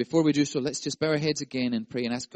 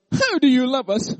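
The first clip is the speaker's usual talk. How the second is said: a high, louder whine; whiny people; 10 semitones higher, 9 dB louder than usual. Pretend. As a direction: complain like a spoiled child. Spoiled people asking God.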